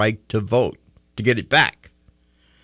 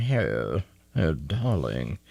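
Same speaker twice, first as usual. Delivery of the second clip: mock posh British; 6 semitones lower, 5 dB quieter, much slower than usual. Mock posh British royal talk.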